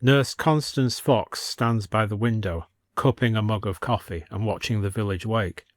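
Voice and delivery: ordinary delivery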